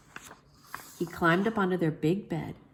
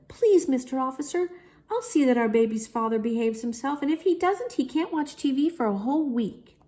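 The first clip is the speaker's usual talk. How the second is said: higher, sweet pleading mother voice; a light sugary mommy voice, higher; 8 semitones higher than usual. A narrator's reading. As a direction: coax the officer sweetly. Mommy sweet-talks the officer.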